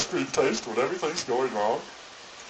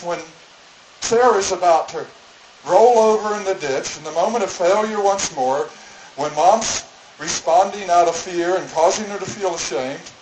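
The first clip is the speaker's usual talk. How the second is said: normal talking voice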